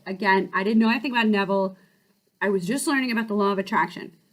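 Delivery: usual speaking voice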